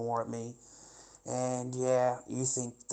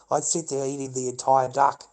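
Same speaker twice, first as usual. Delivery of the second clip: ordinary talk, nothing marked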